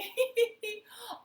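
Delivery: high, loud laughing; joyful laughter. Acted. King laughing with everyone, high laughter. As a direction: laugh along happily. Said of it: "the king was laughing".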